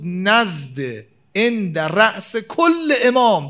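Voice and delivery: slow solemn chant of the Arabic; slow hadith recitation. Acted. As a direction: intone the hadith slowly, leaning on کل.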